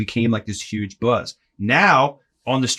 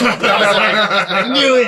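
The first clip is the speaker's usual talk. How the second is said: laughing; 10 semitones higher, 6 dB louder than usual. Retold or imitated. Laughing at the put-down.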